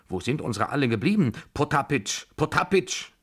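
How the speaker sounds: agitated summons, climbing in pitch; calling out. A narrator's reading. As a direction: call for him insistently. Old lady calling her servant.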